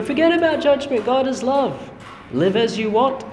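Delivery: high, airy mocking voice of easy faith; mock liberal preacher. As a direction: lampoon a breezy feel-good preacher. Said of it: "many denominations out there who simply go".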